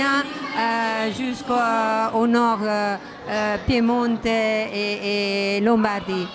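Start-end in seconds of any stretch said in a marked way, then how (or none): none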